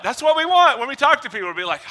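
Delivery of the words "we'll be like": ordinary talk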